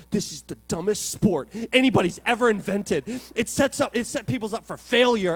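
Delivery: loud, high-pitched retold rant; indignant complaint at full volume; angry outburst. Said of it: "and I'm just angry".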